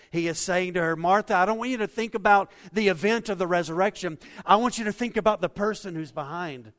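loud, rising preacher's delivery, pressing every line; fervent preaching. Acted. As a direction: preach it full force to the congregation. Pastor preaching at full force.

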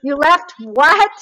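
incredulous yell. Retold or imitated. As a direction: imitate him bellowing the question. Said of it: "he's yelling, he's getting louder".

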